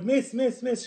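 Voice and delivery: eagerly calling the teacher, acted out; excited. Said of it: "I was so excited".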